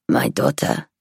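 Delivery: put-on low, rasping elderly woman; rasping old woman voice. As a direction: rasp it out like a frail old lady. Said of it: "in that rasping voice".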